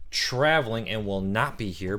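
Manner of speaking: usual speaking voice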